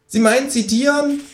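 high squeaky kangaroo voice. Acted. Cheeky kangaroo asking the author, high voice. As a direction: pitch it way up as the Kangaroo.

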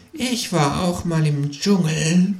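slow, faraway kangaroo murmur; dreamy murmur. A narrator's reading. Kangaroo remembers the jungle in a dreamy murmur. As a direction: drift off as you say it, lost in memory. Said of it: "murmelt das Känguru entrückt".

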